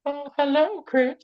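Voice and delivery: very high-pitched cartoon old-man impression; Mister Herbert. Co-host imagining Herbert as Slappy, high Mister Herbert voice. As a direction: lift it way up, playfully creepy. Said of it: "like, a Mister Herbert Slappy".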